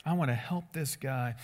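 soft, low and caring; kindly willing to help. Acted. Jesus wants to help this guy, gentle and unoffended. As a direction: drop it gently, with quiet intent to help. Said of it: "That wasn't his attitude".

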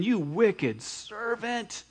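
slow, hushed, weighted scolding; stern rebuke. A narrator's reading. Master rebukes the wicked servant, slow and stern. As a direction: drag each word out in judgment. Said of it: "he said".

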